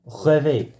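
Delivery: French-accented pronunciation of the word. Real, not acted. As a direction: say it as the French would. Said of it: "pronounced that in a French accent".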